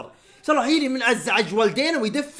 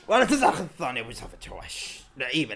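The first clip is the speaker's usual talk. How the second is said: ordinary talk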